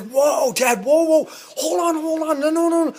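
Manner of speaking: very high, strained mock alarm; hammed-up panicky protest; panicked pleading. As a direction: act out frantically stopping someone. Son pleading to stop dad shooting.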